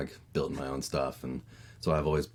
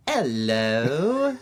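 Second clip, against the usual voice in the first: put-on high, sing-song British toddler hello; a tiny Peppa Pig hello, pitched high; toddler's British hello; 9 semitones higher, 6 dB louder than usual. Daughter popping out on the playground, in a British toddler hello. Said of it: "popping out and going".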